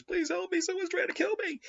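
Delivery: very high, thin mock-terrified pleading; falsetto plea. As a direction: squeak it out like a cornered victim. Hunted man pleads on train.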